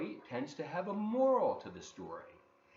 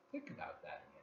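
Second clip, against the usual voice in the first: soft whispered prompt; hushed invitation to think; 11 semitones higher, 14 dB quieter, much faster than usual. Real, not acted. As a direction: whisper it to the listeners. Teacher prompts listeners to think.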